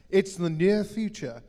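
trailer voice. Acted. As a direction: punch "near" like a film trailer. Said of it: "It's your best trailer voice".

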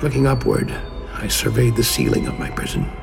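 ordinary talk

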